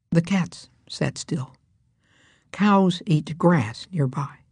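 ordinary delivery

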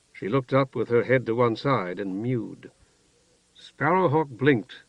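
plain reading voice

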